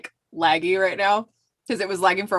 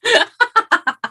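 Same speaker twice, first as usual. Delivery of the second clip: laughing; 9 dB louder, much slower than usual. Real, not acted. Laughing relief after surviving.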